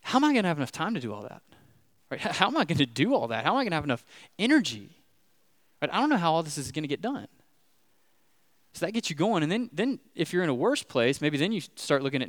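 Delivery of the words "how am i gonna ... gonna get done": fast, worried self-talk; anxious inner voice. Retold or imitated. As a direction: rattle off the worries in a rush. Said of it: "you're like".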